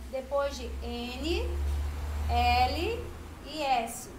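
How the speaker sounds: Depois de N, L e S slow, L called out loudly; emphasizing the rule. Shown as a demonstration. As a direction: hammer home the letters of the rule. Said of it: "Olha a regrinha".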